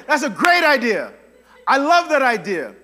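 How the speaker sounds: loud, delighted king with a laugh; eager king. Retold or imitated. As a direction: beam at the idea. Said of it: "what does the king say".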